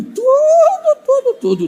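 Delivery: very high falsetto squeal; comic exaggeration. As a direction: stretch it out in silly falsetto. Exaggerating how children watch everything.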